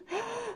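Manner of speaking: soft startled gasp; high sharp gasp. Acted. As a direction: catch your breath at the mess. Reader reacts to mess.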